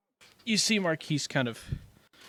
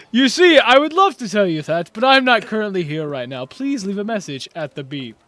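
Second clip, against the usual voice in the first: voicemail greeting; 5 semitones higher, 10 dB louder than usual. Pretend.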